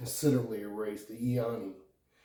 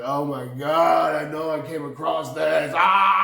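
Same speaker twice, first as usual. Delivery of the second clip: re-enacted excitement; 2 semitones higher, 7 dB louder than usual. Recalling the first discovery, excited and building loud. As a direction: relive the thrill, rising into a yell.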